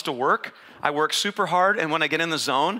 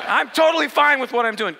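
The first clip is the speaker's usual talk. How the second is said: laughing denial; 6 semitones higher, 5 dB louder than usual. Retold or imitated.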